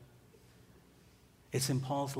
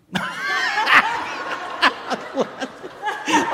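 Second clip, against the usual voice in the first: high breathy laugh at his own joke; real loud laughter at own joke; 10 dB louder than usual. Real, not acted. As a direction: laugh at your own line.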